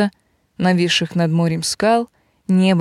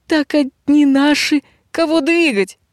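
servant's voice; 8 semitones higher than usual. Acted. Servant answers the bandit.

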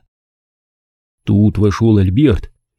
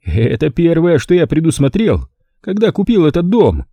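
higher, proud host voice; 8 semitones higher than usual. A narrator's reading. Stanton proudly showing the guest his bell button. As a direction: show off the house with pride. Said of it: "гордо произнёс он".

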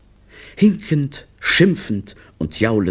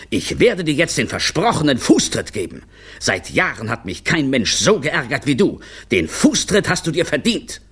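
contained, higher-pitched angry tirade; angry bellow; 7 semitones higher than usual. A narrator's reading. Director threatening the clown with a kick, contained bellow. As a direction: rail at him with restrained force. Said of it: "brüllte Brunelli".

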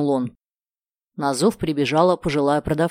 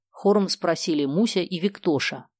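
ordinary delivery, nothing marked

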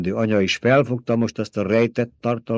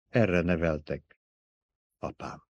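gentle; 3 semitones lower, 6 dB quieter than usual. A narrator's reading. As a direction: comfort her father gently. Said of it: "szelíd hangon szólt hozzá".

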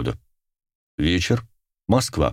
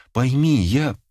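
higher-pitched, pleading appeal; 7 semitones higher, slower than usual. A narrator's reading. Girl imploring her lover to understand, pleading. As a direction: beg to be understood, breaking off. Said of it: "взмолилась Марина".

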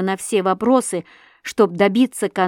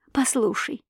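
high, breath-like murmur; hushed coaxing; 5 semitones higher, 4 dB quieter than usual. A narrator's reading. Wife draws the girl to confide, hushed coaxing. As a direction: coax her in a sudden hush.

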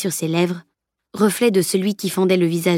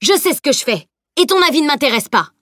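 high, emphatic put-down; stern, heated dismissal; furious rebuke; 7 semitones higher, 3 dB louder than usual. A narrator's reading. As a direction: hammer it home angrily. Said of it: "asséna le maître d'armes".

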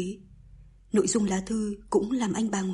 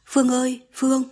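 mother calling her daughter, higher and louder; 4 semitones higher, 7 dB louder than usual. A narrator's reading. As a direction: raise the voice to summon someone from another room. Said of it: "bà gọi con".

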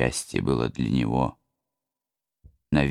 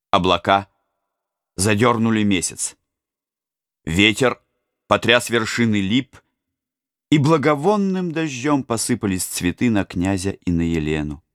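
louder, higher storytelling; dramatic narration; 7 semitones higher, 4 dB louder than usual. A narrator's reading. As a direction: build the storm dramatically.

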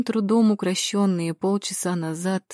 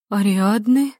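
louder, rising shocked echo; stunned question; 3 semitones higher, 5 dB louder, slower than usual. A narrator's reading. Матя learning who has died, stunned question. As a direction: blurt the name back in disbelief. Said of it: "только и смогла вымолвить Матя".

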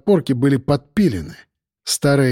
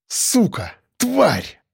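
sudden furious swearing, rising high; angry outburst; 6 semitones higher, much slower than usual. A narrator's reading. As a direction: spit out the curses in anger. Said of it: "вырвалось у меня".

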